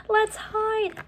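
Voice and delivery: a little sister hissing to hide; Annie's voice. Acted. Annie urging hiding from voices.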